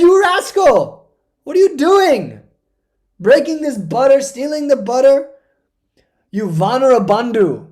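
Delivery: put-on loud, high outburst at a naughty child; angry scolding. Mother scolding her butter-stealing son, angry. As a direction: scold at full voice, stretching the key words. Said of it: "She scolded him".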